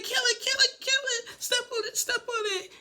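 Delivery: very high frantic squeal, imitating; panicked shriek. Lisa shrieking at Daniel to kill the spider. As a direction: shriek it like a terrified woman. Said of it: "Lisa starts freaking out".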